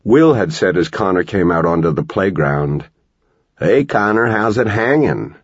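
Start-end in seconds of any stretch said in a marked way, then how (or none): 3.6-5.3 casual, easy greeting with a lift; breezy and offhand, playing it cool; a light, easy hello; smooth and easy. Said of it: "Smooth and easy".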